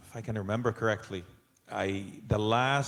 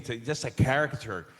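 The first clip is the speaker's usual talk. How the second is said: ordinary delivery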